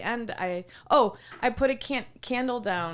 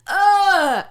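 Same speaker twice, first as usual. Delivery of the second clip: high, loud customer tirade, retold; mock outrage; 11 semitones higher, 10 dB louder, slower than usual. Server mimics customer disgusted by pillow, mock outrage. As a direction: imitate an appalled diner, ending on a loud groan.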